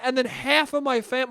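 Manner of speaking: normal talking voice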